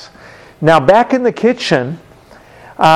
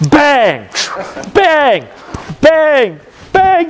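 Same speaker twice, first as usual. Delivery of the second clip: mock bangs climbing higher each time; imitated dish bang; 11 semitones higher, much slower than usual. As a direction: slam out each bang, pitch rising. Dishes slammed to get attention.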